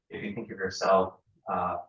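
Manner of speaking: normal talking voice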